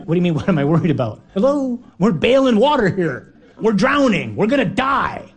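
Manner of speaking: fast, rising exasperated panic; hammed-up frantic disciple; mock panic. Disciples answering Jesus in the sinking boat, mock panic. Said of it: "And we're like".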